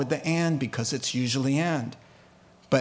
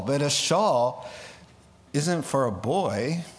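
normal talking voice

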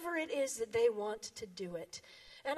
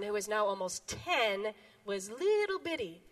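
normal talking voice

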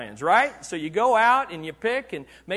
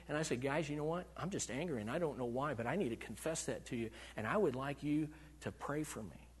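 much softer, intimate sharing voice; soft, personal preacher's aside; hushed confession; 6 semitones lower, 10 dB quieter than usual. Acted. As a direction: lean in and confess it quietly. Quiet confession of morning anger.

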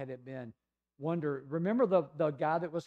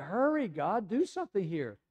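high-pitched acted urgency; pressing, exasperated mock prayer; impatient plea; 5 semitones higher than usual. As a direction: badger God to get moving. Acting out impatient prayer to God.

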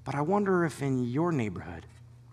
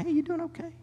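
gentle check-in; 13 semitones higher, 5 dB quieter than usual. Retold or imitated. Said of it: "come over to your house and say".

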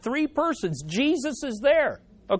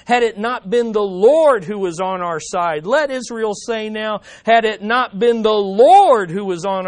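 preacher reading a psalm to the church, fervent; scripture reading; 5 semitones lower than usual. Acted. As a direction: read the psalm aloud as a heartfelt cry. Reads psalm aloud.

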